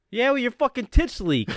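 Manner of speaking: mock-angry shout back; defiant comeback. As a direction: imitate a journeyman firing back an insult. Journeyman shouts back absurd comeback.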